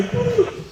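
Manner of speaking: high, quick machine beeps; imitated bucket beeping. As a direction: mimic the beeps of a rising bucket.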